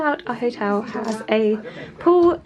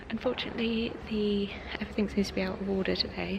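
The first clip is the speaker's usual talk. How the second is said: soft unvoiced whisper; 13 dB quieter than usual. Real, not acted. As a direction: whisper because the room echoes. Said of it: "I feel like I have to whisper".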